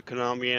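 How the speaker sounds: Konami with the K pronounced aloud; sounded K. Real, not acted. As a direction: say the K at the start of Konami. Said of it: "You don't pronounce the K".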